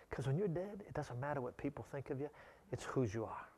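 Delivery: soft, hushed sermon point; quiet. Acted. Preacher softly driving home his point, quiet. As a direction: drop to a near-whisper for weight.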